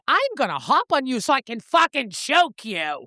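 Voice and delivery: high-pitched mock exasperated rant; driven up the wall. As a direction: act out being fed up with evasive answers. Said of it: "It would have drove me up a wall".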